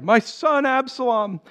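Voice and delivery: high-pitched acted lament; crying out in grief. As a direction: read as a grieving king's cry, no sobs. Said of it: "cried loudly".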